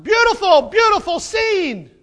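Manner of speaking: loud, high, slowed gushing over the scene; mock rapture. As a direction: swoon over it, overdone.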